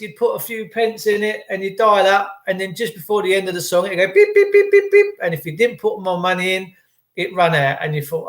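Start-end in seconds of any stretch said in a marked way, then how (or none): 4.1-5.1 high electronic beeping; high beep beep imitation; high pips. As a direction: mimic the phone box pips, high and loud. Imitating phone box pips.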